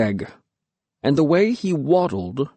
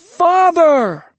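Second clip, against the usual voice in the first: high, loud furious roar; 6 dB louder than usual. A narrator's reading. Disgraced boy roars for his father, furious roar. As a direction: bellow for him across the village. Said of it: "I roared".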